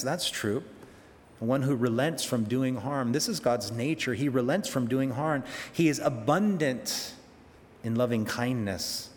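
normal talking voice

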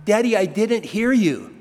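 put-on pleading like a small child; little daughter voice. Preacher mimics his small daughter, in a high innocent voice. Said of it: "She'd go".

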